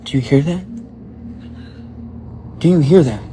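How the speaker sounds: loud, anxious call into the empty store. Real, not acted. Clerk alone at night calls out to the empty store, loud and spooked. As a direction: shout it out to the empty room, spooked.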